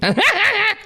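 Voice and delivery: sudden high-pitched laugh; real laugh, squeaky and brief; high burst of laughter. Real, not acted. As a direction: crack up, high and short. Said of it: "And I'm not laughing".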